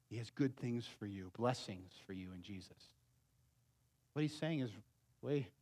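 ordinary delivery

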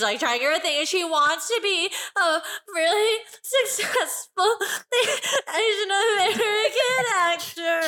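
put-on choked-up child voice, laughing through tears; a little kid about to cry; tearful. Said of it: "Just got really sad".

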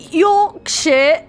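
put-on high, loud mangled Yorkshire accent; fake Yorkshire accent. Host mocking own failed try, bad Yorkshire accent. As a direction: yelp it in a botched British accent, aiming at Yorkshire. Mock attempt at the accent, corrected.

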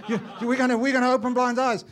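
a son promising to open blind eyes; eager son. Retold or imitated. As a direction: shout it like a keen young preacher. Sons voiced in retold talk.

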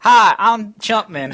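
high, loud, slow put-on stranger voice; a loud, dopey voice for an invented guest; fake guest voice. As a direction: pretend to be a whole new guest. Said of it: "a different voice".

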